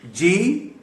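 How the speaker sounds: जी said like the Urdu polite जी; letter G as jee. Shown as a demonstration. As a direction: say जी like answering someone. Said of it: "ये बिल्कुल वही". (अक्षर G "जी" की तरह)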